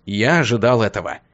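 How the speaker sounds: ordinary delivery, nothing marked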